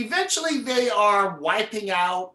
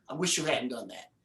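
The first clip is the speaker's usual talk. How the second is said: calm and ominous; 6 semitones lower, 6 dB quieter than usual. Retold or imitated. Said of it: "the guy sits back up and goes".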